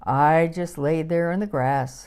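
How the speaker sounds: usual speaking voice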